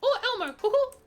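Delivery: very high falsetto cartoon mouse; squeaky cartoon impression with a hoot; Mickey Mouse. Shown as a demonstration. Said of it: "That's, that's Mickey Mouse".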